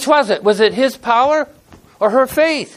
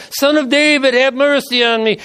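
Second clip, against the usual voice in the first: pleading call, lifted higher; crying out louder; 6 semitones higher than usual. A narrator's reading. Blind beggar crying out to Jesus, pleading loud. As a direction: beg louder over the crowd. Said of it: "cried out all the more".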